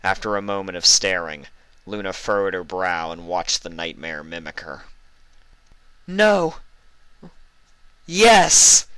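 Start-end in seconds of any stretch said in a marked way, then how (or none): 6.0-8.8 high, loud sinister twist on yes; eerie reply. Acted. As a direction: toy with her, gleeful and eerie. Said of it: "came the eerie reply".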